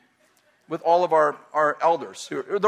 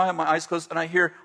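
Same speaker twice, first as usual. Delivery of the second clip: ordinary talk, nothing marked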